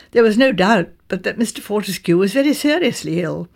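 ordinary delivery, nothing marked

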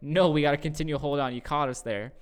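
loud, insistent imagined protest; mock loud objection. Imagined people protesting loudly to stop the talk. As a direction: play the crowd shouting you down.

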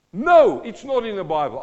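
high, loud burst of mock disbelief; mock shocked no. Real, not acted. Preacher pretending to be shocked at the verse, high loud no. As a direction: gasp it out as if scandalised.

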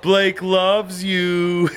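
very slow, drawn-out taunt; drunken teasing. Acted. Drunk friend outing a crush at the bar, drawn-out teasing. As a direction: stretch every word to tease.

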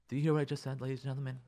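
usual speaking voice

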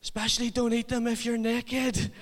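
high-pitched comic holler; shouting. Retold or imitated. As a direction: imitate a loud boy yelling urgent advice across a room. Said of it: "And he shouts".

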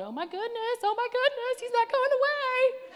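very high, increasingly loud squeal; mock panic. Another girl fretting that he's staying, hammed-up panic. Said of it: "you got another one going".

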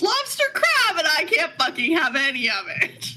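high whiny lament; mock lament. Real, not acted. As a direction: wail it like a tragedy. Lament over lost foods.

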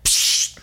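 imitated radio pop and hiss; vocal radio squelch; radio crackle. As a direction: make a sharp "psst" of static. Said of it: "hear pop over the radio like".

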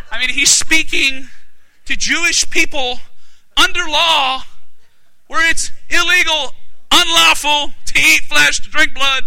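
high-pitched emphatic preacher; forceful preaching. Real, not acted. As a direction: preach it in punched, separated phrases.

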